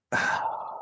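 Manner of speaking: soft, breath-only sigh; exasperated sigh. Retold or imitated. Reacting to the episode, exasperated sigh. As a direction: let out a weary breath.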